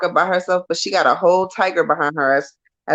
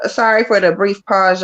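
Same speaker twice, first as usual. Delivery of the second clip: normal talking voice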